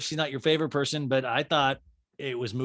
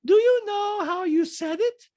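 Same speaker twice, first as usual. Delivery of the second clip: loud, high, slow mimicry; Diana; 6 dB louder, slower than usual. Diana challenging Sutton's tone, high mock impression. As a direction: imitate her challenge way up high. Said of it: "And Diana goes".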